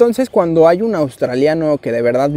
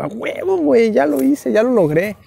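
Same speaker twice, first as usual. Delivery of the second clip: excited boast; 8 semitones higher than usual. Retold or imitated. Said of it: "Y yo".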